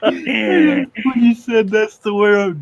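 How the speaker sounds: talking through laughter; giggling while explaining; laughing. Real, not acted. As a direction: barely get words out while laughing. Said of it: "I lost it".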